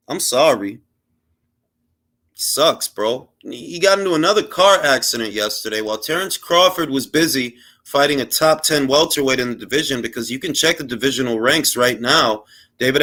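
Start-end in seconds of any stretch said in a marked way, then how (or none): none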